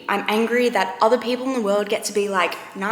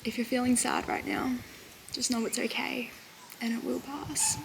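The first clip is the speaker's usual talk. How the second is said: soft whisper, fading out; whispered comfort; 2 semitones higher, 9 dB quieter than usual. Real, not acted. To sad viewers, a soft whispered reassurance.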